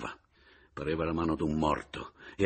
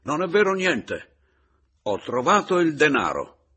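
loud, high, emphatic outcry; shouting; 9 semitones higher, 9 dB louder than usual. A narrator's reading. Narrator telling the caporale money is found, shouting. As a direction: shout the denial. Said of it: "gridai alla caporale".

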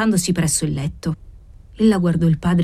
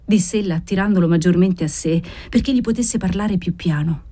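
ordinary delivery, nothing marked

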